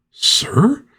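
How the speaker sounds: high, incredulous echo; mock disbelief. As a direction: squeak the question in put-on disbelief. Bystander echoes word in disbelief.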